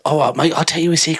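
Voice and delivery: hesitant attempt at an English accent. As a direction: try an English bloke's accent. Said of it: "So do that accent".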